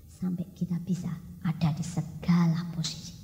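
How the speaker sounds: usual speaking voice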